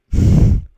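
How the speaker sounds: loud breathy burst of laughter; sudden voiceless laugh; real laugh. Real, not acted. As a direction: laugh out loud at your own unlikely plan. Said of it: "I'm laughing 'cause that's never gonna happen".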